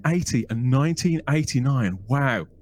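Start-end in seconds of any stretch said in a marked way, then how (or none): none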